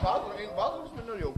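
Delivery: talking through a full mouth of food; words mushed around a big bite. Real, not acted. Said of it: "So tönt er mit vollem Mund".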